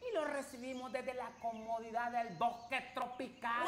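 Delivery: put-on bright, upbeat children's host; kids show host. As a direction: welcome the little ones cheerfully. Host greets kids in forest.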